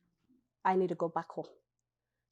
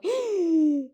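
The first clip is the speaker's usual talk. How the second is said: sharp gasp; 8 semitones higher than usual. Retold or imitated. Shocked by the elevator closing.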